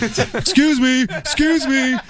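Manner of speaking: high, slow calling out "excuse me"; drawn-out high call across a restaurant; calling the waiter. Retold or imitated. Dad calling out to a stranger he mistook for the waiter, high and slow.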